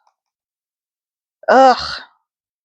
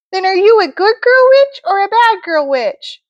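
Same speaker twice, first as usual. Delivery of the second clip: high, sweet fairy voice; sing-song good witch; Glenda the good witch; 9 semitones higher than usual. Acted. Glenda repeating question.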